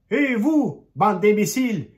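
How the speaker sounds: high, loud yell at the pigs; shout. A narrator's reading. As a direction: bellow it to scare the pigs. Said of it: "et crie". (crier fort et aigu sur les cochons)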